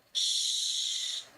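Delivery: drawn-out "shh" of pure breath; long hushing shush. Acted. The peacock silences the admiring crow, long hushing shush. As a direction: hush them with a long shh.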